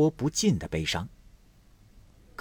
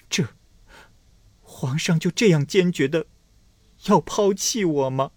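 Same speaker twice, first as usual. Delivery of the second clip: hurt plea; 9 semitones higher, 4 dB louder than usual. Acted. Abandoned consort asks.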